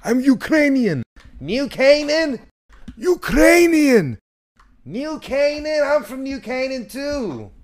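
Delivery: very high, squawked caricature voices; mock old grandparents. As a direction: trade origins in a piercing falsetto, drawn out. Acts grandparents shouting origins at each other.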